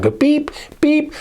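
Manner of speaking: high slow beep beep; button beeps. Acted. Asking viewers to press subscribe, high beeps. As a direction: squeak the beeps. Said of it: "mimics pressing button".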